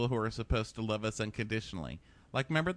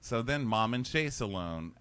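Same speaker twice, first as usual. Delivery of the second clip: ordinary talk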